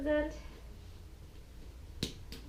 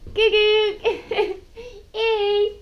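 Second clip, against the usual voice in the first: laughing; 9 semitones higher, 9 dB louder, much slower than usual. Real, not acted. Laughing at the opened bag.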